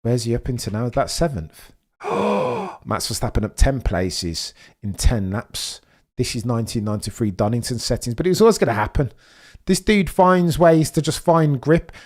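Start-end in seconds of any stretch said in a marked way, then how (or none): none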